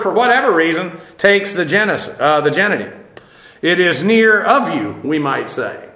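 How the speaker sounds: usual speaking voice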